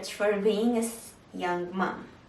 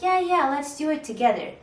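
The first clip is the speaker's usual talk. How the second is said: higher and louder, eager to join in; eager husband; 6 semitones higher, 5 dB louder than usual. Retold or imitated. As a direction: cheer her on to take the test together. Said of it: "And he's like".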